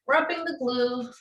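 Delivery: ordinary talk, nothing marked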